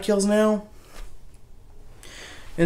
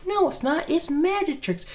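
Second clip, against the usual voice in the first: mock babysitter; 10 semitones higher, 4 dB quieter than usual. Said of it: "babysitter answering kid".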